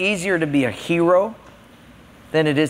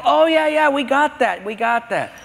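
eager servant; 8 semitones higher than usual. Acted. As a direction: play the keen young doorman. Servant answers Naaman at door.

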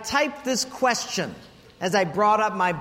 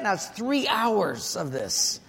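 usual speaking voice

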